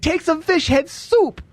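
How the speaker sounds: high, slowed mock Filipino accent; imitating Tony's mom's thick accent. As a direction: insist on the soup, slow and high in a Filipino accent. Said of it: "Her accent is so effing thick".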